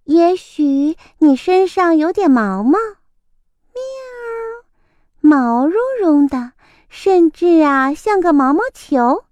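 coaxing little cat; kitten voice. A narrator's reading. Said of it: "小猫说".

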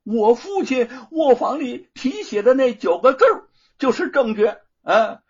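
high, loud haughty retort; loud arrogance. Acted. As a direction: sneer it loudly down at him. Luo Pang arrogant retort.

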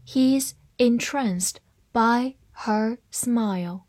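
word by word, slowly; slow, careful model sentence; slow reading. Shown as a demonstration. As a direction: leave a gap after each word for learners. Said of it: "好，慢慢来读".

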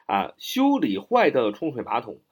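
ordinary talk, nothing marked

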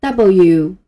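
W said loud and slow as a model; "W" as the English letter name, syllables joined; letter demo. Shown as a demonstration. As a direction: model "W" for the class to repeat. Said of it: "把以上这几个音连起来读一下".

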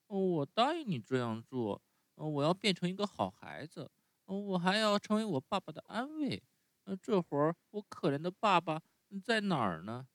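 高出许多的稚嫩童声，带着依恋; 像小木偶一样怯生生地撒娇; 木偶孩子的声音. Acted. Narrator voices Pinocchio. (用高高的童声扮演匹诺乔)

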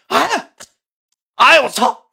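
sudden high yelp of shock; high startled yelp; startled cry. Retold or imitated. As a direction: jump at being grabbed. Startled when mask yanked off.

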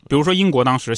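ordinary delivery

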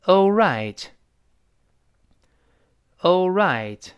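"all right" weighted on "right"; stress on second syllable. Shown as a demonstration. Teacher models for learners to repeat, stress on "right". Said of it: "注意重音在后面".

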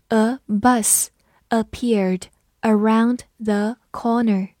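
slow, each word set apart; slow reading. Shown as a demonstration. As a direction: read it slowly for learners to follow. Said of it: "我们慢读一遍".

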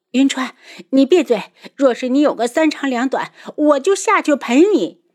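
high, loud, impassioned outburst; vehement vow. A narrator's reading. Daughter to Yunchuan, ready to die with him, vehement. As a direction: cut him off and vow fiercely.

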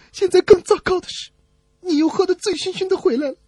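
a lightly voiced tearful wife; upset wife. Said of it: "妻子却哭了".

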